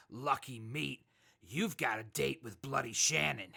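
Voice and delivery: normal talking voice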